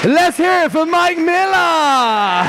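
slow, high, drawn-out hype call; rally the crowd for the player; hyped announcer. Acted. Host calling the live audience to cheer Mike Miller, drawn out and hyped.

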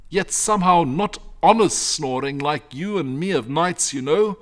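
high, loud, animated shepherd voice; uneasy shepherd. Acted. Shepherd telling his family of noises from the cave, uneasy.

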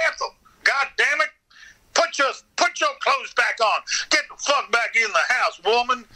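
high, mock-angry hillbilly holler; hillbilly yelling at wife.

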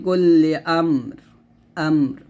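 slow recitation stopping on আমর; measured tajweed reading; Quranic recitation. Shown as a demonstration. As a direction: chant the verse slowly and pause at আমর. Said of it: "এখানে আমি থামলে এরকম থামতে পারি".

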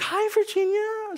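high, slow, sugary hello to the teacher; sugary mom greeting. Retold or imitated. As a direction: greet her all sweetness, drawn way up high.

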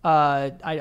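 ordinary delivery

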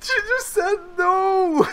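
high, loud, slow laughing cry; laughing shout. Real, not acted. Friend refused to let her join.

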